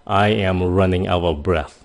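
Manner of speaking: slow and careful, with a clear "th" at the end of breath; slow teaching read. Shown as a demonstration. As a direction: read it slowly for learners. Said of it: "mình ví dụ những câu cho các bạn". (đọc chậm, rõ âm "th" cuối)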